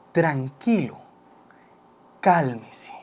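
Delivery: hushed, soft and low calming words; soothing whisper. Shown as a demonstration. Mentor showing how to calm the caller, whispered softly. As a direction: whisper them down gently, like settling a scared caller. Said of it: "es decirle".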